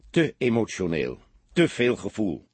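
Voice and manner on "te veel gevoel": ordinary delivery, nothing marked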